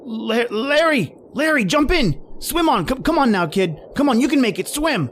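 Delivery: frantic shout from the shore; urgent shouting. Acted. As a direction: drive him to swim for it. Urging him to swim.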